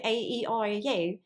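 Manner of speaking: Irish vowels placed further back; Irish accent demo. Shown as a demonstration. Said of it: "that's the Irish accent".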